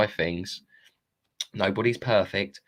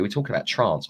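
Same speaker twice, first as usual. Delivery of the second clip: ordinary talk, nothing marked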